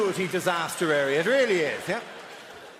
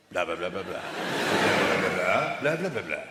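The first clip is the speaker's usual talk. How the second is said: low mock-speech babble; wrong way demonstrated; 11 semitones lower than usual. Shown as a demonstration. Barrister showing the wrong way to turn, low babbled patter. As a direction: babble a courtroom speech on blahs, pitched well down.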